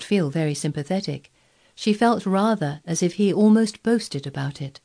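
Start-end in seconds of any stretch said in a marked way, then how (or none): none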